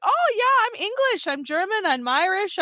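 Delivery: high-pitched mocking imitation; mock boastful American. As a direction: send up a breezy claim, pitched high. Mocks Americans claiming nationalities.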